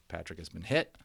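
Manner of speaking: plain reading voice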